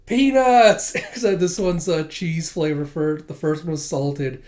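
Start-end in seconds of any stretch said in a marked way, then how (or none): 0.1-0.9 much higher, louder proud shout; excited reveal. Acted. Anya showing Becky her snack, high excited shout. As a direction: announce it like a treasure.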